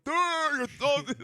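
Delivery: very high loud screech; mock toothless vendor screaming; toothless yelling. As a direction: yell it through puckered lips. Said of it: "now he's screaming".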